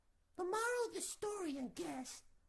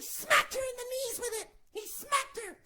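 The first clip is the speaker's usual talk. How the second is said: laughing; 7 semitones higher, 9 dB louder than usual. Real, not acted. Laughs telling it.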